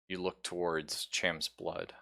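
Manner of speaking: ordinary talk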